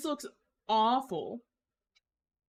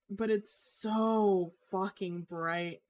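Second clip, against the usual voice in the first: normal talking voice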